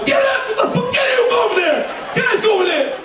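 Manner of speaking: very high shouted orders; a cop yelling at everyone to break it up; frantic screamed commands. Retold or imitated.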